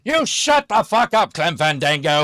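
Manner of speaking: yelling the name at the engineer in a rage; furious shout. Retold or imitated. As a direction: bellow it, fed up and explosive. Furious Toast shouting at Clem.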